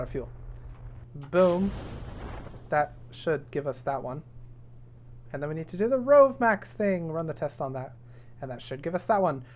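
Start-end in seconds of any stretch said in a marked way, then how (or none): none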